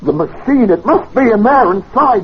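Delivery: high, loud and desperate; frantic urgency. Acted. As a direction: shout it as if the door won't budge. Frantic attempt to break door.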